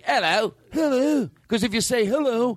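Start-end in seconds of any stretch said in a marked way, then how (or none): 0.0-0.5 Cockney hello, higher with rising end. Shown as a demonstration. Host explaining Cockney to friends, Cockney accent. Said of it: "Cockney is like". 0.7-1.3 high, falling mock mobster hello; brought-down mafia hello. Shown as a demonstration. Host contrasting with Cockney, mock mafia hello. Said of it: "it just sounds like".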